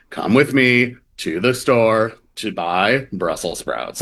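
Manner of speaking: slow, drawn-out influencer cadence; imitated creator-speak with measured pauses between phrases; TikTok creator speak. Shown as a demonstration. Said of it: "this phenomenon of the creator speak".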